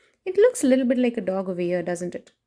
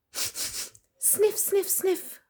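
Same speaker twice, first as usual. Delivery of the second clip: dog sniffing; 10 semitones higher, much slower than usual. Acted.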